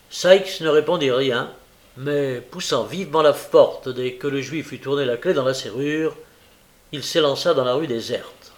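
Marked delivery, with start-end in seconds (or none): none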